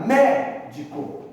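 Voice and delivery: "médico" with MÉ punched out; "médico" as MÉ-dico, first syllable strong; stress on first syllable. Shown as a demonstration. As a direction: hit the first syllable of "médico", let the rest fall. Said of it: "a sílaba mais forte é a antepenúltima".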